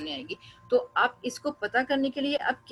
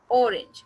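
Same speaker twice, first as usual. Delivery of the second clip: Orange with a loud ऑ and a weak range; stress on first syllable; 5 dB louder than usual. Shown as a demonstration. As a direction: hit the ऑ of orange, let range drop. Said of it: "ओ पे हमने stress दिया".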